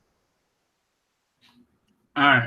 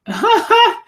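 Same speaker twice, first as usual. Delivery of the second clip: high burst of laughter; 5 dB louder than usual. Real, not acted. Laughs at the battle scene.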